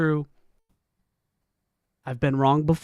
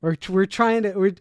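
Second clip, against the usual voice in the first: ordinary delivery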